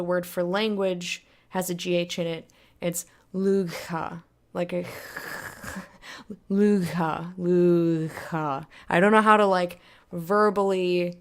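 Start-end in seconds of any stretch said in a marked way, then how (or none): 3.3-4.2 "lugha" with a throaty, voiced gh; fricative gh sound. Shown as a demonstration. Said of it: "it's similar to the Scottish C H". 6.5-8.7 drawn-out "lugha" with a throaty gh; fricative gh sound. Shown as a demonstration. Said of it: "that's what it sounds like".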